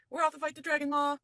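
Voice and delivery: high, loud call across the cave; shout to mother. Acted. Finesse shouts to his mother as he leaves, loud call. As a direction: holler it to Ma from far away. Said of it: "Finesse shouts to his mother".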